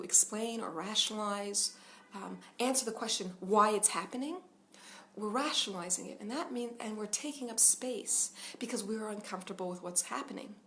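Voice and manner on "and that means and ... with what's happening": ordinary talk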